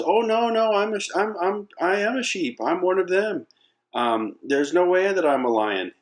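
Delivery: very high little cub voice; protesting lion cub. Acted. Little cub insisting to the lion he's a sheep, pleading.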